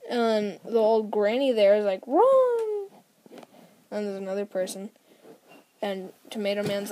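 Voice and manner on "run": very high, loud, held-out cry; acted alarm cry. Old granny warning of Tomato Man, acted alarm cry. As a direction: sing out a mock warning, stretched long. Granny warns people in comic.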